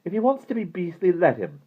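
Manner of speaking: put-on high, upset girl's voice; strained and unhappy. A narrator's reading. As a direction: urge him to drop it, a touch strained. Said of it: "Hearing the strain in her voice".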